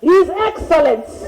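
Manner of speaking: slow, grand royal address; grand royal announcement. Pretend. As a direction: hail him like a king. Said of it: "In my home".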